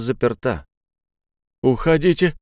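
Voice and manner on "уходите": strained shout. Acted. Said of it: "сдавленный голос продавца".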